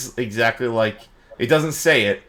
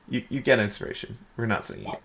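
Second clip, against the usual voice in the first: usual speaking voice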